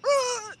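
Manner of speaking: very high mock shriek; hammed-up squeal; imitated cry. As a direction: mimic a high cry without real volume. Imitates friend's startled cry.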